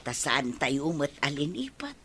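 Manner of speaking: usual speaking voice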